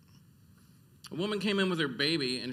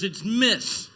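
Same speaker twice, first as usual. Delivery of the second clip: a sharp, louder snap; woman correcting how she's addressed, sharp and louder; snapped; 4 semitones higher, 6 dB louder than usual. Retold or imitated. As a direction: bite the correction back at him. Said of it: "she snapped at me".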